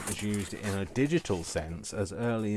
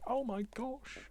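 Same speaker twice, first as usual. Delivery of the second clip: mock horror; 3 dB quieter than usual. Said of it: "be all going".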